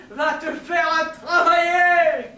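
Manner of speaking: loud, drawn-out high shout; shouted send-off. Acted. Sending a friend off to work, long shout. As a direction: yell it, stretching the last word.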